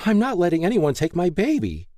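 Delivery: high-pitched, fiercely protective; fierce declaration. A narrator's reading. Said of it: "Lay fiercely declared".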